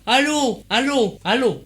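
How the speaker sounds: appelle dans le micro, plus fort. Real, not acted. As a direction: crie allô pour tester le son. Mic seems dead, calling out. (appel sonore pour vérifier le micro)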